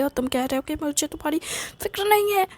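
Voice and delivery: fast, rising into a high shout; mother shouting in one breath. Acted. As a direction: blurt it all out in one breath and end in a yell. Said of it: "चिल्ला क्यों रही हो? एक सांस में बोलने की".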